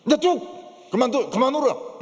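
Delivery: high-pitched, imitated mother's order; 엄마의 호령. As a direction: order a crying child to stop. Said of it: "엄마가 와서 뭐라고 이야기합니까". (우는 아이를 달래듯 높게 다그치는 엄마 흉내)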